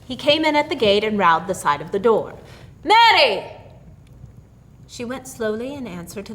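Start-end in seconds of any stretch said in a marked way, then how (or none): 2.8-3.5 louder, higher call of her name; husband's summoning yell. A narrator's reading. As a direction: shout for his wife from the door. Husband calling at the door.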